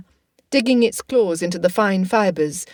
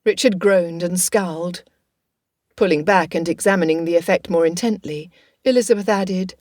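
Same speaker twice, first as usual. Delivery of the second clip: plain reading voice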